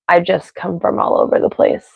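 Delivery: ordinary talk, nothing marked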